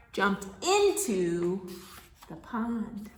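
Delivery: animated storytime narration with big swells; reading to children. Acted. Reader telling a farm story to small children, animated storytime voice. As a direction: stretch out key words for little listeners.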